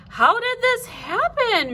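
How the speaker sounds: high, slow astonished question; baffled father at the window; surprised. A narrator's reading. Father climbing in, astonished. Father finds house on dragon.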